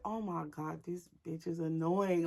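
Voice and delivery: very soft exasperated mutter; hushed, fed-up grumble; annoyed aside. Retold or imitated. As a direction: mutter it under your breath. Annoyed aside about the wailing sister.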